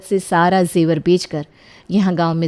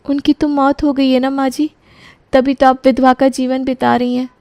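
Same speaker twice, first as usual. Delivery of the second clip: put-on young daughter-in-law; 7 semitones higher than usual. बहू का अलग किरदार.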